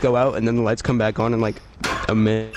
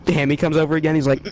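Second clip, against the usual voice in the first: usual speaking voice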